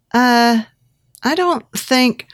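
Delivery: high, drawn-out uncertain refusal; reluctant hesitation. A narrator's reading. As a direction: balk at the offer, slowly. Balks at his proposal.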